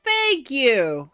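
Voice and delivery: loud, high, drawn-out thanks; emphatic mock relief. Reader cheering the girl's free will, emphatic. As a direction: exclaim it with exasperated gratitude. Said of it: "And I'm like".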